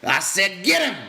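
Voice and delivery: loud, high insistent yell. A narrator's reading. George yells again at Lenny to fight, loud. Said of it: "George yelled again".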